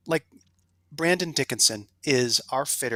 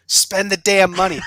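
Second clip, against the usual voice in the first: louder, laughing push; laughing urge; 5 semitones higher, 9 dB louder than usual. Real, not acted. Guest urging golfers to buy a fitting, laughing. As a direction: laugh as you tell them to spend it.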